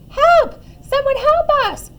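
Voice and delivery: very high, loud cries for help; shrieking storybook panic; panicked wail. A narrator's reading. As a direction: wail as if about to be dropped. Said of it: "wailed the animals".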